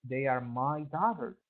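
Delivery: normal talking voice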